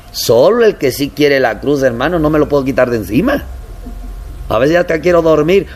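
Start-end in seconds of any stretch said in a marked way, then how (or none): none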